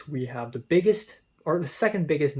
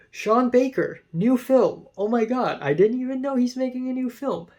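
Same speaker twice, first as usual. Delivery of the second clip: excited; 9 semitones higher, 5 dB louder than usual. Real, not acted. Surprised by a new Sean Baker film.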